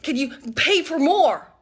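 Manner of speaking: imitated child's demand. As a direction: pester like a demanding kid. Kid pestering her for money.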